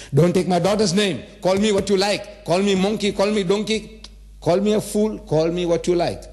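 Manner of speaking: high, insistent pleading protest; indignant protest. Acted. Offended young man to the old uncle, heated protest. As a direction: pile up the demands, pitch held high. Acted listener protesting insults to family.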